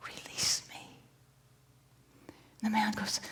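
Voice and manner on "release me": whispering fish. Acted. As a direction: breathe the plea like a dying fish. Fish repeats its plea.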